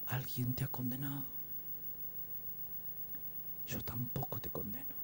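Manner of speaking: soft voice sinking to a whisper; gentle absolution. Acted. Jesus speaking to the adulterous woman, gentle and hushed. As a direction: let it fall away softly, without reproach.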